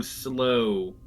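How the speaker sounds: ordinary talk, nothing marked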